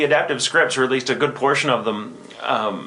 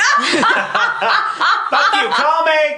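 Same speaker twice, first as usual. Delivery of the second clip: mock yell; 3 dB louder, much slower than usual. Mocking a rude pickup line.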